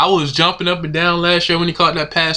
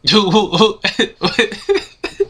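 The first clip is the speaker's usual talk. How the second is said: high, breathy laugh that could pass for crying; helpless laugh at how ridiculous it is; disbelieving laughter; 5 semitones higher than usual. Real, not acted. Fan reacting to his player's shock release, disbelieving laughter. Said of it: "I'm not crying, I'm laughing".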